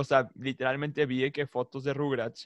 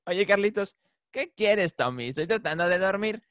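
lightly voiced cartoon kid, pitched up; cartoon dialogue imitation; 6 semitones higher than usual. Re-enacting the viral Carlitos and Tommy bedtime scene, cartoon imitation. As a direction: mimic a cartoon bedtime exchange. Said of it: "me salió ese video en YouTube".

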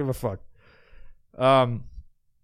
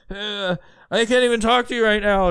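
higher, louder fake distress; mock overwhelmed voice; 5 semitones higher, 4 dB louder than usual. Podcaster mocking a faker's video meltdown, hammed-up distress.

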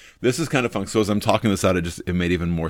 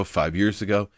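normal talking voice

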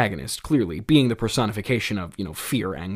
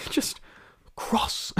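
whispered mockery; 5 dB quieter than usual. Shown as a demonstration. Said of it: "very interesting term to use".

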